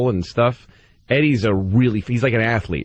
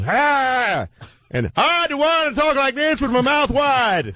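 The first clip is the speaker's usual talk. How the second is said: much higher mock voice with wide-open mouth; exaggerated open-mouthed mimicry; hammed-up impression of his goofy talk; imitating Eddie Murphy; 14 semitones higher, slower than usual. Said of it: "when he talks like this".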